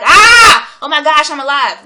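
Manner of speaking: loud, high-pitched yell of amazement; exuberant scream. Acted. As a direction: scream it out, overjoyed to be alive. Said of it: "I just wanna scream and be like".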